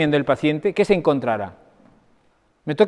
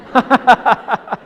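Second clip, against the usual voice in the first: hearty laugh; 8 semitones higher, 4 dB louder than usual. Real, not acted. Joke about his daughter.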